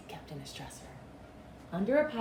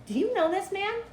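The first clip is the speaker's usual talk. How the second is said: put-on high polite child; little boy's voice; 12 semitones higher than usual. Little boy approaching the ice vendor, high and polite. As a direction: ask politely like a small boy.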